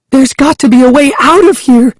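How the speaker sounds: fast, high, frantic cry; rapid high-pitched desperate shout; breathless panic. A narrator's reading. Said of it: "she answered breathlessly".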